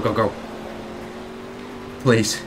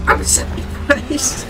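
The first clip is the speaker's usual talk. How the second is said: squeaky laughing; 12 semitones higher, 4 dB quieter, slower than usual. Real, not acted. Squeaky laugh while stuck.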